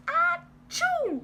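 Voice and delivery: loud high exaggerated sneeze; big sneeze. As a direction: let out a huge sneeze. Wolf sneezes.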